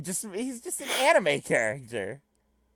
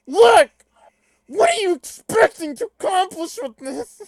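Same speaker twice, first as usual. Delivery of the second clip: loud, high, strained comic shout; hammed-up high-pitched impression; Bobcat Goldthwait; 11 semitones higher, 10 dB louder than usual. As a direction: yelp it out in an exaggerated high voice. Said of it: "Can I do Bobcat Goldthwait for Equius?".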